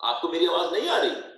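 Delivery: usual speaking voice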